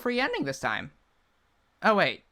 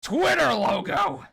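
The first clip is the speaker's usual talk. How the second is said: announcer shout; 6 semitones higher, slower than usual. Acted. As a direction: call it out like a ring announcer. Summons a fighter.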